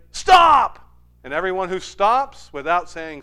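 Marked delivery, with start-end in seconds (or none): 0.1-0.8 sudden loud high "Stop!"; shouted command. Retold or imitated. Pastor as Simon tricking players out, sudden shout. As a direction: bark it to catch them out. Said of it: "I'll say".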